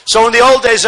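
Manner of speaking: ordinary talk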